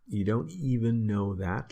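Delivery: EVEN lifted high in pitch; stress on EVEN. Shown as a demonstration. Teacher translating niet eens, stress on EVEN. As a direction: lean on "even" in disbelief. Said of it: "the emphasis".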